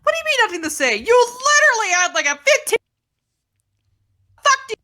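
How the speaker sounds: high, loud indignant protest; outraged yell. Real, not acted. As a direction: yell back in mock outrage. Outraged protest at a teasing jab.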